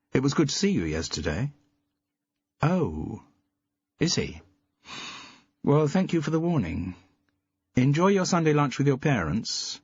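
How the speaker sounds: cheerful on the phone; phone chat voice. A narrator's reading. Jude on phone, bright voice.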